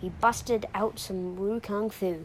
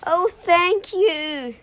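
high, loud, slow cry of relief; hammed-up storybook scream of gratitude; screamed thanks; 8 semitones higher, 10 dB louder, much slower than usual. A narrator's reading. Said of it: "when Lou screamed".

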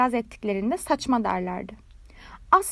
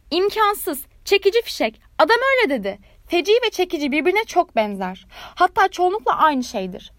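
indignant protest; 5 semitones higher, 4 dB louder than usual. A narrator's reading. Rocket protesting while falling.

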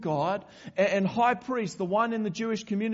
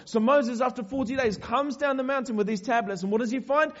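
ordinary talk, nothing marked

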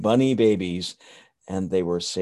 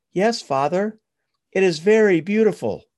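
1 little bunny voice; 10 semitones higher, 5 dB louder than usual. Acted. Ruthie answers her father.

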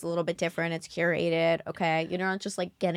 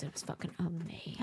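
whisper; 11 dB quieter than usual. Real, not acted. Raving about a restaurant.

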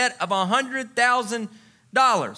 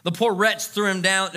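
ordinary talk

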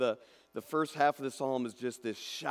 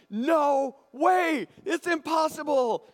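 much higher and louder fan shout; shouted, high-pitched disbelief; ecstatic shout; 11 semitones higher, 5 dB louder, slower than usual. Retold or imitated. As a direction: yell it like a fan whose team just won.

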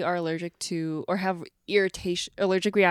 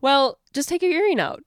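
mock doctor; 13 semitones higher, much slower than usual. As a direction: toss it off like the careless doctor. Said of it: "She goes".

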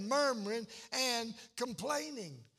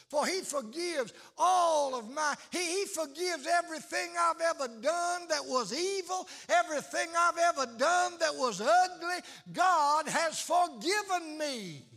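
loud, higher, pounding sermon climax; full-force preaching; 3 semitones higher, 6 dB louder than usual. Acted. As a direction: preach it to the back row, building.

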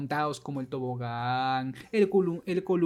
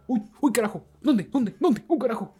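put-on confused kid; 6 semitones higher, 3 dB louder than usual. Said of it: "se confunda y diga".

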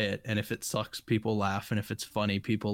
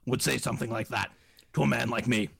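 Alex Jones impression. Alex Jones voice, outraged at his kind neighbors. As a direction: rage at the absurdity like a conspiracy host. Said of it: "That's an incredible impersonation".

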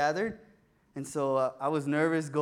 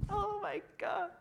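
high slow swooning oh my God; audience swooning; 7 dB quieter than usual. Real, not acted. Listeners hear he was loved back, high swooning. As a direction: crowd melts at the ending.

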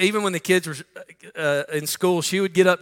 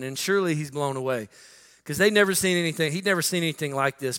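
usual speaking voice

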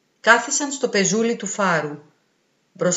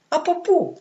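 higher, puzzled question; 9 semitones higher than usual. A narrator's reading. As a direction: ask it baffled and unsure. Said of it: "ρωτά ο γέρος με απορία". (με απορία, πιο ψηλά)

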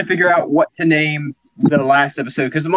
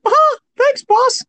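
much higher, nervous office underling; nervous employee voice; much slower than usual. Acted. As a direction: squeak it out like a scared clerk. Timid employee in office improv.

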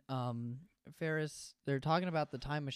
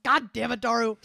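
imitated exasperated yell, higher and loud; mock angry outburst; Okabe yelling; 5 semitones higher, 8 dB louder than usual. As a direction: yell it like you're scolding a sidekick. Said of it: "he just yells at Daru".

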